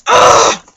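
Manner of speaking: real exasperated yell; frustrated ugh. Real, not acted. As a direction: let out a sharp fed-up ugh. Frustrated outburst after apology.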